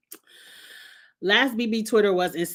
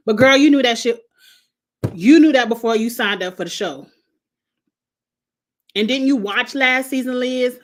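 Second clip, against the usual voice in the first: louder, scolding a contestant through the screen; exasperated scolding; 3 semitones higher, 6 dB louder than usual. Acted. Vlogger scolding hungry Liz on the show, exasperated louder scolding. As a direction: let her have it, half laughing.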